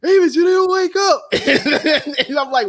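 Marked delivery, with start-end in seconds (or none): 0.0-2.3 loud, high quote through laughter; laughing quote. Retold or imitated. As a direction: blurt it out laughing. Quoting scene through laughter.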